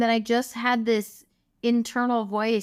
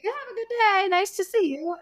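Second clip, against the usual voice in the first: high, breezy doctor's sign-off; a lightly mocking, much higher chirpy goodbye; mock casual brush-off; 12 semitones higher than usual. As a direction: toss it off like nothing's wrong. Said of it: "like your casual Tuesday".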